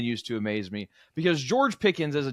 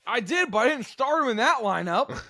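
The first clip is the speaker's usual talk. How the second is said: mocking echo; 7 semitones higher than usual. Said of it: "S1 echoes mockingly".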